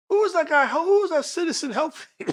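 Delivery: high, loud, imitated puzzlement; incredulous. District attorney asking officers about video, high and incredulous. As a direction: act out a baffled attorney, voice shooting up. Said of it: "'Cause they couldn't believe it".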